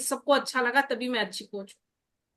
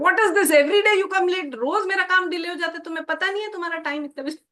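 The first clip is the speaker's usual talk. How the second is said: hammed-up scolding, fast at the end; scolding the maid; 6 semitones higher, much faster than usual. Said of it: "Didn't say".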